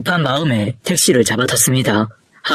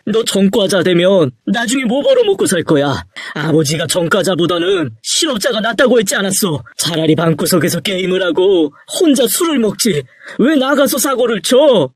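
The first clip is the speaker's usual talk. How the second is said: worked-up father, raised pitch; exasperated scolding; 5 semitones higher than usual. Acted. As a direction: berate a wayward son. Father berates son over future.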